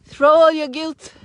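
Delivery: ordinary talk, nothing marked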